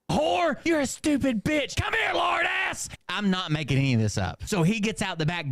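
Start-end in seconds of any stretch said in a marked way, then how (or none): 0.1-2.9 high, drawn-out mock-furious screaming; hammed-up slow screech of rage; screaming abuse. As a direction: shriek insults like a raging mom. Replay of mom's screaming.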